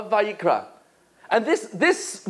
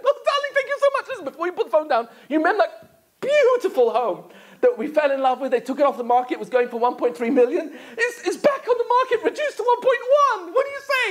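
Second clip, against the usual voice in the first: much higher, giggly wheedling wife voice; gushing wife; 7 semitones higher, faster than usual. Acted. Wife on speakerphone coaxes her husband into a house, much higher and gushing. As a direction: pile it on like a wife after a mansion.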